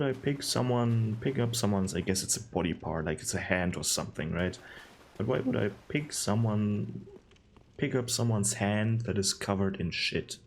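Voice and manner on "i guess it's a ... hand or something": ordinary talk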